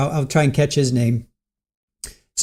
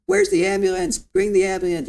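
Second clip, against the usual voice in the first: imitated hysterical screaming. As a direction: mimic a frantic caller in a squeaky-high wail. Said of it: "She was just screaming and hollering".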